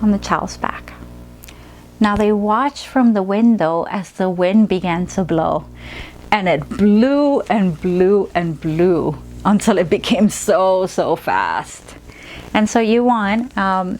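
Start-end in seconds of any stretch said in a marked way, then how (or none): 2.0-12.0 slow, dramatic story read, dropping low at the end; storytelling to a child. Acted. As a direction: build the windstorm for the child. Story narration of wind.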